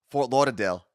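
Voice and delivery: Fort Lauderdale in a light imitated Jersey accent. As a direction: echo her Jersey way of saying Fort Lauderdale. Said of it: "I feel like you went real Jersey on that".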